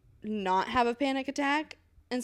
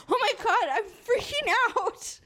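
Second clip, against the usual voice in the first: mock panic; 11 semitones higher than usual. Patient retelling her panic attack to friend, mock panic. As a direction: reenact your freak-out with amused exaggeration. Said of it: "and I'm like".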